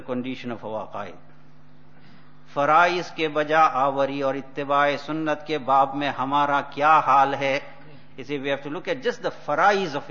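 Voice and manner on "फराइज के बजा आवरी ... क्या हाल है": rapid, loud recitation of Urdu text; fast Urdu reading. Acted. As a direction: read the Urdu passage quickly and forcefully. Reads Urdu text in sermon.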